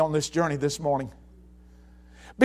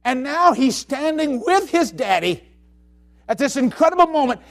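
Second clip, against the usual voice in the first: ordinary talk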